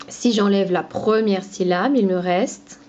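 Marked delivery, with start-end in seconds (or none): none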